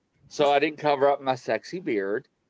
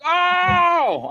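high, loud yelp of surprise; mock a startled gasp; retold shout of shock, pitched way up; startled cry; 4 dB louder than usual. Retold startled reaction to dolls.

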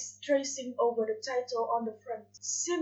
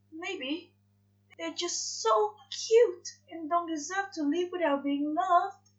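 higher, earnest angel voice; 6 semitones higher, slower than usual. Acted. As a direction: plead for the animals, higher. Aziraphale pleads for animals.

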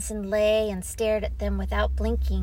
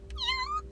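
very high mewing meow; a little cat's meow; kitten meow; 7 dB quieter than usual. Acted. Kitten chats with Teddy at night, high meow.

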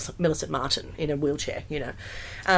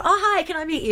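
mock gushing; 12 semitones higher than usual. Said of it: "go up to people and go".

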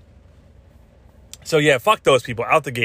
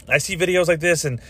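normal talking voice

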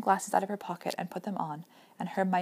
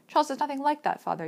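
higher, louder plea; insistent, raised girl's voice; anguished protest; 6 semitones higher, 5 dB louder than usual. A narrator's reading. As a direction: urge a father to understand. Anguished daughter protests to father.

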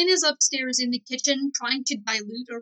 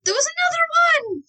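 much higher, squealed protest; high-pitched insistent yell; 5 dB louder, faster than usual. Acted. Acted popsicle quarrel.

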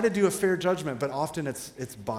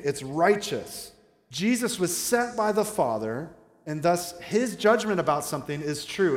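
normal talking voice

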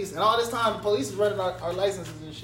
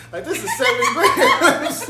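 laughing; 5 semitones higher, 6 dB louder, much slower than usual. Real, not acted. Laughing retelling seven grams.

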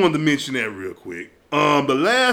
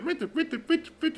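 high, soft squeaky creak noise; creaking imitation; 10 semitones higher, 11 dB quieter than usual. Retelling a party story, imitating the creaking bed. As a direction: mimic a fast squeaky bed.

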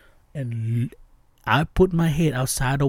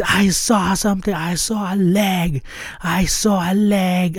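frantic telling; 5 semitones higher than usual. Retold or imitated. Driver frantically telling his girlfriend about the leg. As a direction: insist on what you saw. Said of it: "Like, I s".

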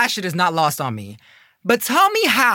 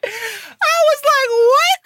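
stunned disbelief; 4 dB louder, much slower than usual. Retold or imitated. Retelling total disbelief.

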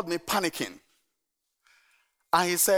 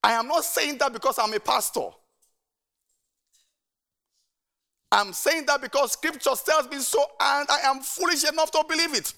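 rising conviction; 8 semitones higher than usual. Real, not acted. Pastor building emphatic conviction.

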